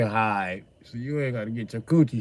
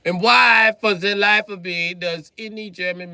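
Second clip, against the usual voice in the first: high, loud, outraged demand; exasperated yell; 9 semitones higher, 8 dB louder than usual. Real, not acted. Demanding German makers explain themselves, yelling. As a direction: bellow the why at them.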